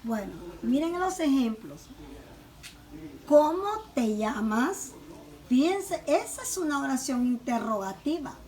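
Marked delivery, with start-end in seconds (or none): 3.3-4.9 CÓMO punched, llamas rising into a question; teacher's model question, stressed CÓMO, rising llamas; question intonation. Shown as a demonstration. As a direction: ask "¿Cómo te llamas?" with a clear questioning lift at the end. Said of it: "la pronunciación cambia, que se note que es una pregunta".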